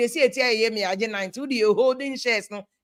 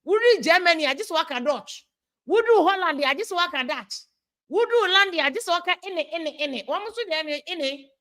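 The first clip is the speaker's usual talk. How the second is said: higher, mocking imitation of a European accent; 5 semitones higher than usual. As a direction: parody a Ghanaian faking a European accent, pitched up. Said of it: "accent ni bi".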